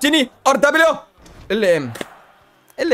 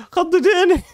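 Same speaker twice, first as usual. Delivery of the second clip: high laugh through the words after a scare; nervous relieved laughter; startled laugh; 6 semitones higher, much slower than usual. Real, not acted. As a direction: laugh off the fright.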